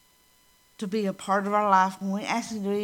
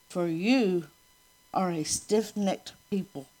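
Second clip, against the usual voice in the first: scripture reading; 3 semitones lower, slower than usual. Acted. Reads scripture aloud.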